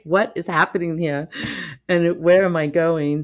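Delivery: half-laughing what on earth am I doing here; amused astonished outburst; bewildered. Retold or imitated. Bewildered at posh hotel tea.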